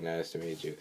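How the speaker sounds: low, soft sarcastic greeting; mutedly sarcastic, imitating a character; retold sarcasm. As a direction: drop the voice for a dry mock greeting. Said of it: "like, sarcastic".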